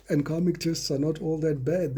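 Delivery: ordinary talk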